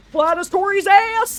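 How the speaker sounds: very high, very loud mock Scottish shout; bellowing fake Scottish accent; fake Scottish yell. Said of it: "Don't yell at me in Scottish".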